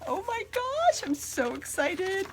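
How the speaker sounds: high, thrilled squeal; excited squeal. Real, not acted. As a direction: squeal with real delight. Unboxing the long-awaited bag.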